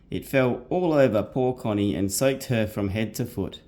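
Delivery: put-on reading a storybook to kids at bedtime; bedtime story reading. A narrator's reading. Dad reads bedtime story aloud.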